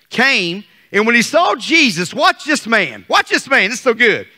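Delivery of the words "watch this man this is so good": usual speaking voice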